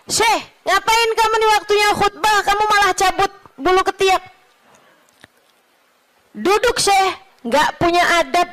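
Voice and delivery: high, fast reproachful scolding; indignant rebuke. Retold or imitated. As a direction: tell him off for his manners.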